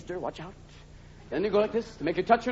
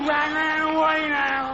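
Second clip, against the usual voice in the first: put-on higher, very slow slurred drunk; one long slur, no consonants; 7 semitones higher, much slower than usual. Jack Daniels drunk slurring words, slow and higher.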